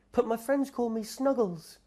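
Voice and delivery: very high, timid little creature; small monster. Acted. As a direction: speak as a tiny shy monster.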